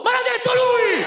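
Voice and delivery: put-on very high, loud child's protest; kid's outraged shout. Child protesting to the entertainer, shrill outraged shout. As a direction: shriek it like a wronged little kid.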